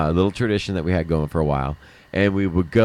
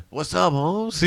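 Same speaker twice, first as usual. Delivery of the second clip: put-on old school Mexican guy; 9 semitones higher, slower than usual. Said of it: "an old school Mexican guy my age".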